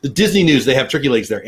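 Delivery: ordinary talk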